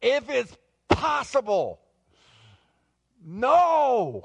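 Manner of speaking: high, loud, pleading cry; anguished prayer. Acted. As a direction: act out desperate praying, rising to a wail. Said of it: "prayer Jesus is praying".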